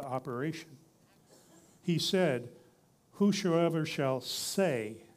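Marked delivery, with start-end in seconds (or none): 3.1-5.0 slow, "say" set apart and drawn out; stress on SAY. Real, not acted. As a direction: lean hard and long on "say". Preacher drives home key word.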